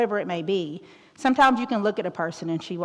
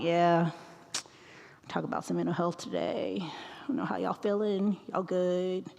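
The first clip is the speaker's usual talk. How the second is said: boring presenter; 5 dB quieter than usual. Pretend. A dull presenter greeting students, listless and soft. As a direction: mumble it like you couldn't care less. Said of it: "She is lame. She is boring".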